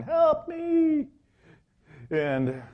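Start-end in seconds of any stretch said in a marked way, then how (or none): 0.0-1.1 high, squeaky mock plea for help; mock cry for help. Aaron at the projector, teasingly voiced as a high mock plea. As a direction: pretend to be stuck and squeak for rescue. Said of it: "Aaron's going back going".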